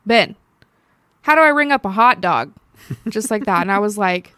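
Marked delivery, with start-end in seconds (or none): 0.1-2.5 loud called-out question, mock cashier yelling; retold yell. Cashier calling across the gas station to Ben, loud mock yell. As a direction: call across the store to a coworker. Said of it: "he yells across the".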